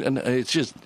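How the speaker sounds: ordinary talk, nothing marked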